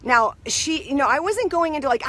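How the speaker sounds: ordinary talk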